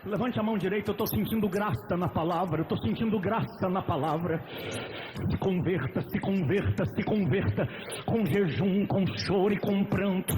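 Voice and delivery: full-force altar call; loud preaching. Acted. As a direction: push the call to convert hard. Preacher at full force, music behind.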